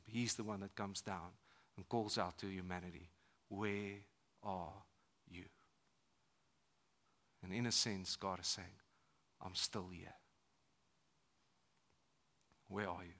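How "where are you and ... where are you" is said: soft tender plea. Acted. God's quiet searching voice.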